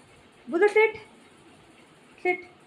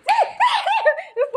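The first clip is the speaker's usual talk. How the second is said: high shrieking laugh. Real, not acted. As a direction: burst of high-pitched laughter. Laughs as something falls.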